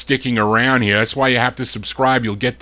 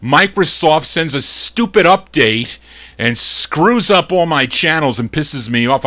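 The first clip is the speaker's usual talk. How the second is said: normal talking voice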